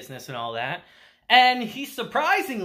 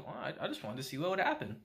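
much softer and fast, almost mumbled; sheepish mumble; 6 semitones lower, 12 dB quieter, much faster than usual. Retold or imitated. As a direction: shrug it off quickly and quietly. Uncle explains sheepishly.

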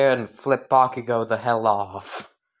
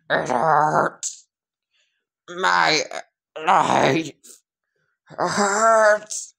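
dying groan; 3 semitones higher, much slower than usual. Acted. Melting villain's dying words.

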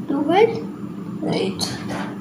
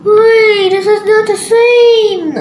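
higher, louder, drawn-out protest; dismayed surprise; 5 semitones higher, 5 dB louder, slower than usual. Real, not acted. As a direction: stretch it out loudly on finding a mistake. Sudden discovery pieces do not match.

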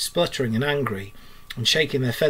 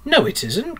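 high-pitched, indignant contradiction; storybook huff of indignation; 10 semitones higher, 4 dB louder than usual. A narrator's reading. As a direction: cry out in offended protest. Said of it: "cried the Rat indignantly".

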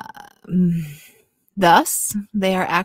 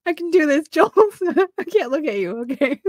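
laughing; 10 semitones higher, 6 dB louder than usual. Real, not acted. Laughing through reading attempt.

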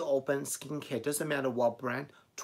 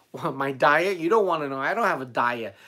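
amused disbelief; 3 semitones higher, 8 dB louder than usual. Real, not acted. Vlogger reacting to a viewer's diet question, amused disbelief. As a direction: blurt it out, loud and incredulous.

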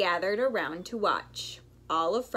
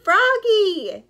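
loud, high shout; urgent drawn-out yell; 9 semitones higher, 10 dB louder, much slower than usual. A narrator's reading. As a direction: holler it across the distance. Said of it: "he yelled".

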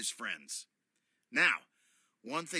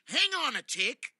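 excited; 11 semitones higher, 7 dB louder than usual. Acted. Interrupting excitedly.

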